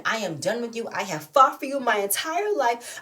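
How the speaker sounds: ordinary delivery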